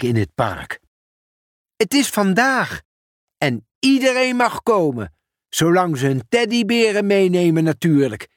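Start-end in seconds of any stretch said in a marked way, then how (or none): 1.8-8.3 put-on light, lifted kid's voice; lightly voiced eager child. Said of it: "zei Sjaki".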